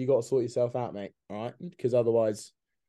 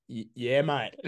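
mock Australian; slower than usual. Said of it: "You're Australian".

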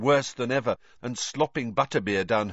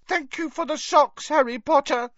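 high, louder elf call, unhappy; miserable elf calling; 5 dB louder than usual. A narrator's reading. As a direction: elf calling after Harry from the hearth. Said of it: "Dobby called miserably".

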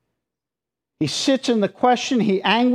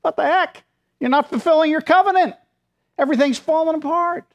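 high, fast exasperated complaint; exasperated psalmist; 8 semitones higher, faster than usual. Retold or imitated. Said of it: "And then the psalmist goes".

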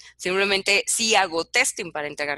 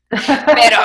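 giggling nervously, laugh spilling into the word; an uneasy laugh while telling something awkward; 9 dB louder than usual. Real, not acted. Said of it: "Es esa risa nerviosa".

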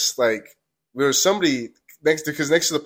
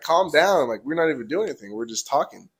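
ordinary talk, nothing marked

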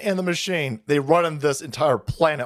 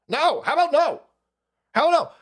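defiant refusal; 10 semitones higher than usual. Real, not acted.

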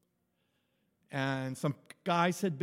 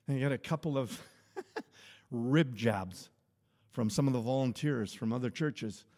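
normal talking voice

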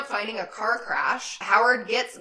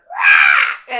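deep, loud breathy roar; jump-scare growl at the girl; monster roar; 14 dB louder than usual. Retold or imitated. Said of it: "all of a sudden you hear".

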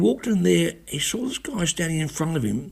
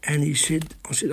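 usual speaking voice

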